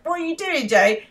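high, puzzled question; high bewildered. Retold or imitated. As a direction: ask it high and baffled. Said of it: "he's like".

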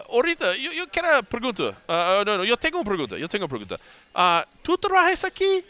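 high, halting tourist Spanish, fumbling for words; broken Spanish. Retold or imitated. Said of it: "I went full Spanish".

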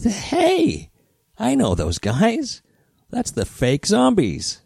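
high, slow astonished exclaim; surprised recognition. Retold or imitated. Rick spotting the fake Zombies on TV, surprised. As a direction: shout it like you can't believe your eyes.

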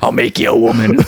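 hammed-up raspy smoker impression; Harvey Fierstein. Podcaster riffs as the movie mom for laughs, husky impression. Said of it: "She talks like Harvey Fierstein".

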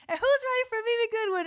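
high, fast hype call; event host. Retold or imitated. Host introducing the little-known speaker, hyped. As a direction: imitate an emcee keeping the energy up. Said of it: "And then he was like".

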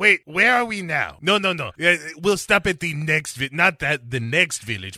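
hammed-up evasive castaway accent; Jon Voight's muddled accent. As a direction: correct yourself hurriedly in a mongrel foreign accent. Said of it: "So Jon Voight jumps onto the boat".

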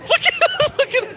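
high, loud, cracking up; gleeful laughing shout. Real, not acted. Pointing out the pale actor, helpless laughing yell. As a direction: shriek it through real laughter.

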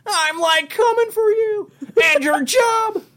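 high Shaggy voice, half laughing. Pretend. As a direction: shout it in a high cartoon voice. Continues Shaggy impression, laughing.

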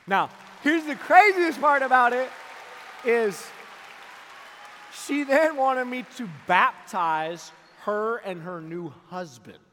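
preacher building to the big reveal; preaching at full force. Real, not acted. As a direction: proclaim it to the room.

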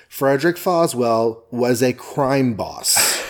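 slowed, every word spelled out; emphatic. Real, not acted. Host repeating a point to the whole audience, slow and emphatic. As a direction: drill it home slowly for the people in the back. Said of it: "I say it for the people in the back".

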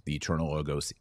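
ordinary delivery

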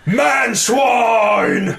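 a high, shouted orc battle cry. Retold or imitated. Orc captain shouting at the player on camera. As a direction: bark it like an orc captain on his entrance. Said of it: "and they go".

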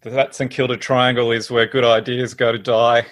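ordinary talk

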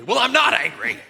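loud, high mock-angry outburst; retold grumpy snap. Husband denying anger to his wife, hammed-up grumpy outburst. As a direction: blurt an indignant denial, hammed up. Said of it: "I could get really grumpy really fast".

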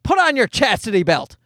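dad ordering his daughter to her room; strict dad. Retold or imitated. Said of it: "Then I'd be like".

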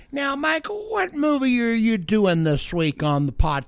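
put-on high, slow old-lady voice; hammed-up sweet grandma asking; grandma impression. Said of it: "Does she ever say".

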